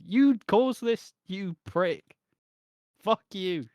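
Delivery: high, loud furious jab; angry outburst. Real, not acted. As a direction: spit it at him in real fury. Ranting at the character.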